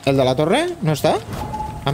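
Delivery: usual speaking voice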